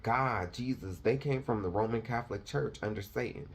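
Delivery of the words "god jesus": ordinary talk, nothing marked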